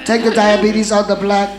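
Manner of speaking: loud, forceful preaching, often high; shouted deliverance commands. Acted. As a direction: bark commands at the demon at full force.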